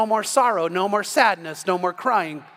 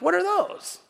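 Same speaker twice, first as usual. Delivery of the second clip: baffled; 11 semitones higher, slower than usual. Retold or imitated. Puzzled at ugly tulips in store.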